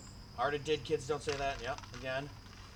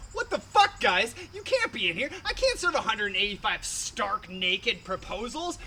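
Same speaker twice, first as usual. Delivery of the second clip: mock outrage, pitched up; high, incredulous protest from a bartender; indignant bartender; 4 semitones higher, 4 dB louder than usual. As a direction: object in hammed-up disbelief. Acted bartender confronting naked customers.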